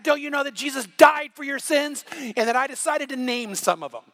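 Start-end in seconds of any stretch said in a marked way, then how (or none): 0.0-2.0 retold yell. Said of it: "we're yelling".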